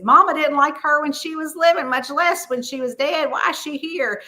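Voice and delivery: lightly voiced indignation; mock indignant cousin. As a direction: imitate a put-out Southern relative. Retelling cousin's gripe at a funeral.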